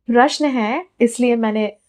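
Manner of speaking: ordinary delivery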